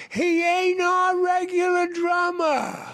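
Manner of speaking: high, drawn-out distant holler; hollered back. Acted. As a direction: call it slowly across a river. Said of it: "an Indian hollered back".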